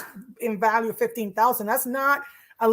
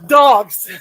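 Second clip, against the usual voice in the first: exasperated; 14 dB louder than usual. Real, not acted. Exasperated at dogs.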